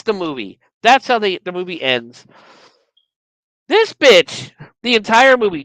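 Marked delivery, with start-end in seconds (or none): 3.7-5.6 indignant rant. Real, not acted. Ranting about Bianca.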